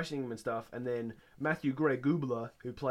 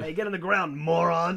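high, loud barked order; mock police yell; 6 semitones higher, 8 dB louder than usual. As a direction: shout it like the rude cop on a raid. Acting out agents storming in.

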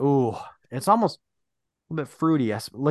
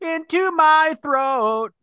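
slow dramatic proclamation; 11 semitones higher, much slower than usual. Pretend. Drinking, dramatic line.

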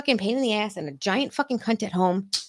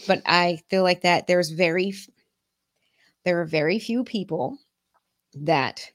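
ordinary delivery

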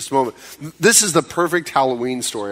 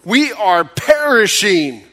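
accusing cry; 6 semitones higher than usual. Acted. Frightened disciples accusing Jesus in the storm, urgent. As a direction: cry out in alarm to the master. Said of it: "It's more of an accusation".

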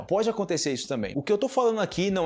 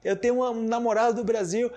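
quoting a student in a raised voice; imitating a student; 7 semitones higher than usual. A student telling the teacher about a Brazilian partner, pitched up. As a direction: play the eager student, pitched up. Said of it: "quando os estudantes falam comigo".

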